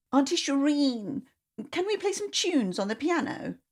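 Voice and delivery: put-on small girl voice asking to play; child asking. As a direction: draw it out like a hopeful child.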